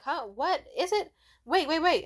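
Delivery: high startled confusion; confused. Retold or imitated. She answers the shouting customer, high confused protest. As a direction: caught off guard, scrambling.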